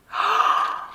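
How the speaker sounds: big theatrical gasp; exaggerated gasp of surprise; shocked gasp. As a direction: suck in air in mock astonishment. Feigned shock at finding a cigarette.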